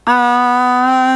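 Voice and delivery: sudden startled yelp; choked cry as he is eaten; cut-off cry. A narrator's reading. Toilet bites Mister Meaner.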